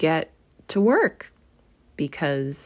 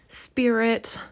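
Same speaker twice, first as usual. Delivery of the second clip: broken voice; 6 semitones higher than usual. A narrator's reading. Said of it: "said Scrooge in a broken voice".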